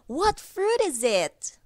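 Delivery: high gasping playful question; mock surprise. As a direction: gasp and ask like a big reveal. Guessing game for children.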